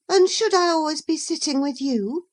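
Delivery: high, loud irritated demand. Acted. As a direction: push back at him, pitched high and loud. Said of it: "she demanded".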